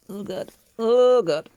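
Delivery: real alarm, building; rising dismay. Real, not acted. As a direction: panic building up. Dismay at part falling out.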